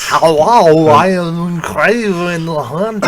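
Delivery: slow, loud mock Russian accent. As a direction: ham up a Russian villain accent. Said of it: "see Arnold Schwarzenegger do a Russian accent".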